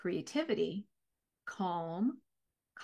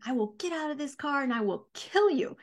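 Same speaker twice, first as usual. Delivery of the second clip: screaming threat; 5 semitones higher, 4 dB louder than usual. Retold or imitated. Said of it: "I am screaming".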